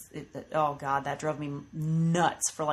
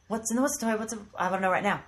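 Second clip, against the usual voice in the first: put-on rushed, run-together toddler words; fast toddler babble; 3 semitones higher, faster than usual. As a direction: rattle it off like an excited kid. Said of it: "he would say it so fast".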